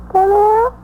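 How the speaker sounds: the bravado cracks into fear; frightened. Acted. Witness asks detectives if they can jail her, frightened and high.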